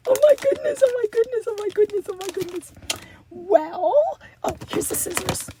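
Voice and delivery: normal talking voice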